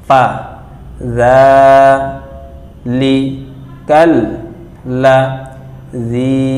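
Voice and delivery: syllables split with pauses; word by word. Shown as a demonstration. Teacher to class, syllable by syllable. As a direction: chop it into syllables. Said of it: "এবার দেখুন শব্দ শব্দ".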